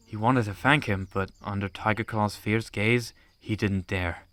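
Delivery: normal talking voice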